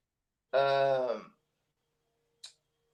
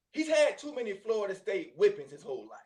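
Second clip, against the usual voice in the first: usual speaking voice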